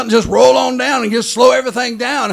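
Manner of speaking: loud, rising preacher's cadence; full-force preaching. Acted. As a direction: drive the faith home to the congregation.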